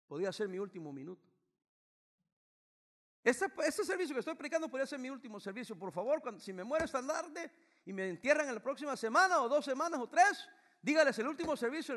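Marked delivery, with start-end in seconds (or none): 3.2-11.9 high, loud pulpit cry; raised preacher's voice; fervent preaching. Real, not acted. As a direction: lift the congregation with it. Preaching at full force.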